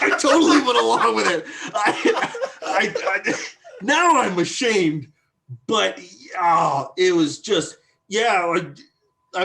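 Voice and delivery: giggly embarrassed confession, fast; laughing. Real, not acted. Sheepish laugh admitting he went along with it. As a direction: laugh through the admission.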